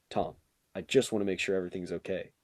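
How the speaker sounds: low, soft, quick check-in; discreet concern. Retold or imitated. Gym teacher checking on student privately, discreet. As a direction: imitate a teacher quietly checking in.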